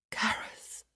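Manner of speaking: soft airy whisper of his name. A narrator's reading. Woman whispering her lover's name. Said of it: "she whispered".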